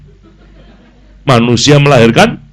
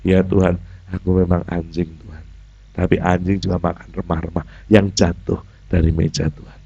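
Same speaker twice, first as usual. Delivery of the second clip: much softer, lower, meek; humble, soft reply; 6 semitones lower, 9 dB quieter than usual. Acted. The woman humbly answering the Lord, soft and meek. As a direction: answer meekly, head bowed. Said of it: "Perempuan ngomong".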